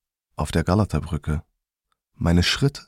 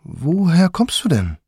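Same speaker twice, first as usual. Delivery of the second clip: put-on young man's come-on; 9 semitones higher than usual. Young man invites the narrator up to his room, luring. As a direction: coax the stranger up to the room. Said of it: "von einem jungen Mann angesprochen".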